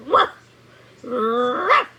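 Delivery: playful imitated woof; dog bark. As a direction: bark like a big shaggy dog. Dog barked.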